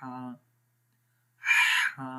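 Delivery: ہا breathed softly from the middle of the throat. Shown as a demonstration. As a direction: let ہا come easily from mid-throat. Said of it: "گلے کے درمیان والے حصے سے". (ہا گلے کے درمیان سے آرام سے)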